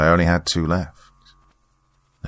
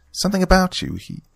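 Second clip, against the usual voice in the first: Margaret trailing off. A narrator's reading. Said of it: "trailed in her words".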